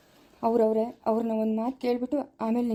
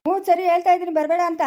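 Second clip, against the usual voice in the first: much higher and louder call; 8 semitones higher, 7 dB louder than usual. Acted. Son calling back to his mother from afar. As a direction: shout across the field to her.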